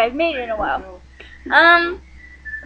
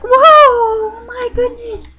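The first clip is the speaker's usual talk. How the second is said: slow, much higher and louder amazed cry; mock amazed shout; 8 semitones higher, 4 dB louder, slower than usual. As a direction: exaggerate delight at the test score, stretched out. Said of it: "you get your test back, you're like".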